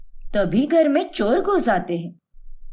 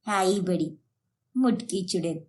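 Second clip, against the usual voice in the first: muttered insult; 7 semitones lower, 7 dB quieter than usual. Acted. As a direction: lower and softer under the breath, low and much softer. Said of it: "यूं भीगी बिल्ली की तरह क्यों बुराइयां". (धीमी आवाज़ में बड़बड़ाती ताना)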